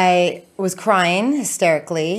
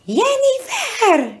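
mom calling Jennifer; much slower than usual. Retold or imitated. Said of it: "'cause my, my middle name's Jennifer".

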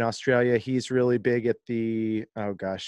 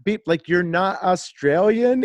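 mock excited disbelief; 8 semitones higher, 5 dB louder than usual. Friend reacting he's not Australian.

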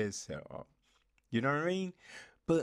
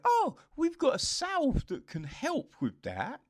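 mock breezy character; 12 semitones higher, 4 dB louder than usual. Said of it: "it's just like".

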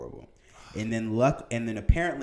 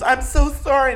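high, loud hammed-up sorry; mock apology; 11 dB louder than usual. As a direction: fake sympathy, cranked up. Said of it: "and she goes".

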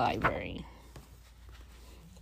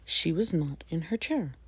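ordinary delivery, nothing marked

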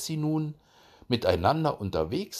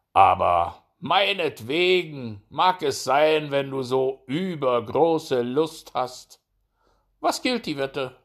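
high, loud, slow hare voice; conceited hare humouring a rival; condescending hare; 4 semitones higher, 6 dB louder than usual. A narrator's reading. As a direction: grant it grandly, drawing out the words. Hare accepts the challenge.

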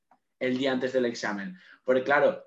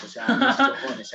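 "mis cojones" stretched out slowly, much higher and louder; an incredulous slow cry of "mis cojones"; emphatic outburst; 11 semitones higher, 6 dB louder, slower than usual. Real, not acted. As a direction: drag out "mis cojones" high and loud in disbelief. Repeats the rebuttal with force.

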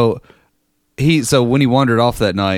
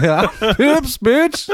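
high mock yell through laughter; laughing mock shout; much slower than usual. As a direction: ham it up while laughing. Echoing the friend's drunk tagline.

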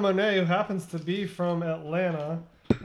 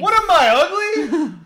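mock offended; 11 semitones higher, 8 dB louder, slower than usual. Mock offense among laughing friends.

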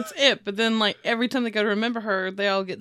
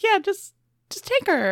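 high-pitched breezy handover; mock breezy offer; 10 semitones higher than usual. Mother hands her baby to a friend, mock breezy. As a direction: imitate someone lightly waving it away.

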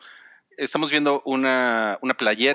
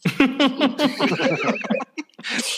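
high-pitched burst of laughter; long laugh in a high pitch; 13 semitones higher than usual. Real, not acted. Host cracking up at a guest's answer, laughing.

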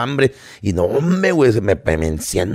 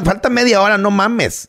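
ordinary delivery

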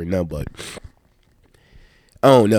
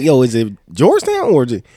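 retold bafflement; 5 semitones higher, 4 dB louder than usual. Baffled quote of himself at Walmart.

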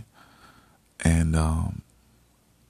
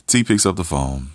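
ordinary delivery

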